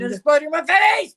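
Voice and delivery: teasing the guide by mimicking his shriek, high and loud; mock scream. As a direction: squeal it like the scared guide.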